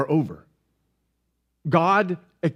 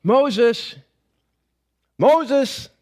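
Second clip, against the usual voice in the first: raised, spaced-out call of a name; 4 semitones higher than usual. Acted. God calls Moses from within the bush, calling out. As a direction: call out, pitched up on the second. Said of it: "God called out to him".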